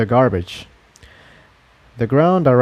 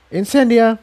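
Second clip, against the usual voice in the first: cutting in; 11 semitones higher than usual. A narrator's reading. Cutting her off.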